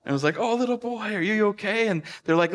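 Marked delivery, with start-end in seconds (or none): 0.4-1.9 put-on high-pitched fussing over a little child; concerned adult. Stranger to a lost little boy, concerned.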